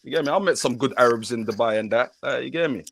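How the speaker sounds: ordinary talk, nothing marked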